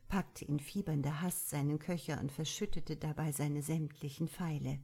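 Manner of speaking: usual speaking voice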